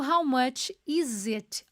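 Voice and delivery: "How much is it?" said slowly, each word drawn out with the linking clear; slow pronunciation demo. Shown as a demonstration. Teacher to class, modelling the question slowly and clearly. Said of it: "Olha a pronúncia".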